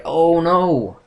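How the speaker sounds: loud, high-pitched oh no; dismayed. Retold or imitated. Fan reacting to the island being destroyed, loud high dismay. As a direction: cry out as the island vanishes. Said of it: "I was like".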